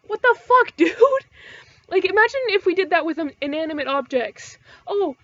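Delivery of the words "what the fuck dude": loud, high what-the-fuck disbelief; incredulous outburst. Real, not acted. Ranting to viewers about people mocking pets, loud incredulous. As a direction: throw it at the absurdity.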